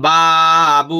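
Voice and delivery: Babu stretched very long; drawn out. Shown as a demonstration. Teacher shows the long vowel to students, very drawn out. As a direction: draw Babu out slowly. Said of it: "মদের হরফ পড়া যায়".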